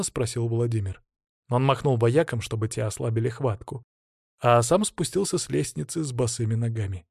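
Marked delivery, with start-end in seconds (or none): none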